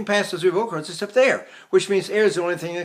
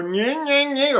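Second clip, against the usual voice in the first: higher-pitched "nyeh" noises; forward-placed nyeh; 9 semitones higher, slower than usual. Shown as a demonstration. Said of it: "to get the voice, uh, uh, forward".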